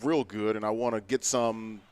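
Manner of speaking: thick Boston accent, dropped r's in park and car. Shown as a demonstration. Cohost asks him to do his Boston accent. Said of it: "do it in your Boston accent".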